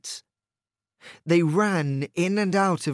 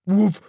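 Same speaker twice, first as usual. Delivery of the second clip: woof; 4 semitones higher, 7 dB louder than usual. A narrator's reading. Dog bark in story.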